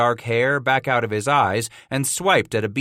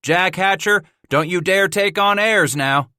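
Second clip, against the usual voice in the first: teasing trapper; 8 semitones higher, 4 dB louder than usual. A narrator's reading. Scratch teasing Jack.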